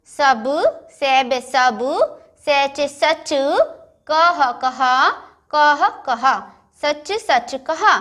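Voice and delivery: गे जे then गज, each syllable drawn out slowly; slow syllable reading. Shown as a demonstration. Teacher reading गज तक चल syllable by syllable. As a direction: blend letters into words very slowly. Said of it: "इसके बाद क्या पढ़ेंगे हम".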